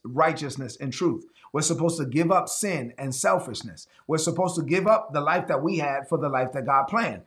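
normal talking voice

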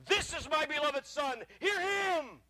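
slow, booming divine voice; voice of God. Acted. God speaking from the cloud, booming. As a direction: proclaim it from the cloud. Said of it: "a voice came out of the cloud and says".

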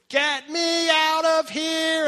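put-on high, loud, drawn-out yell of a three-year-old; toddler screaming. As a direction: stretch every word into a piercing child's holler. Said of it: "screams at the top of her lungs".

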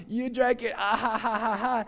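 words running into mock laughter; imitated helpless laughing. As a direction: act out laughing too hard to talk. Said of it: "I'm laughing so hard I can barely talk".

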